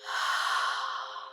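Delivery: long, airy exhale; sigh. Real, not acted. Meditation leader after asking listeners to feel, long sigh. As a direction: sigh the breath out slowly. Audible breath during meditation.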